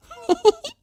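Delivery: a quick high-pitched chuckle. A narrator's reading. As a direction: chuckle like a man who never smiled. Narrator acts out chuckle.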